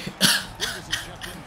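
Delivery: soft voiceless laughing; breathy chuckle. Real, not acted. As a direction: let out a quiet, airy laugh. Laughing at own remark.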